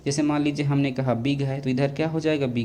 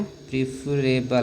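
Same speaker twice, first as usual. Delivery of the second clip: slow; much slower than usual. Shown as a demonstration. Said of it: "preferable मिल जाए".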